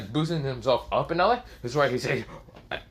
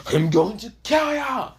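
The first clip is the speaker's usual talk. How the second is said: yelled, high villain threat; angry shout; 7 semitones higher than usual. Acted. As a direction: scream the threat at him. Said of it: "Vector gets annoyed and says".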